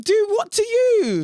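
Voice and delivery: slow, high, loud scoff; exaggerated mocking disbelief; incredulous. Real, not acted. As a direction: drag it out mockingly. Repeats the mocking retort.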